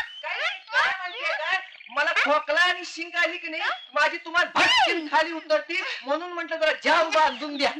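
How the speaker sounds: high, whiny put-on servant voice; simpleton servant. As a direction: explain innocently in a high servant voice. Plays simple servant before mistress. (भोळ्या नोकराचा बनावट उंच आवाज)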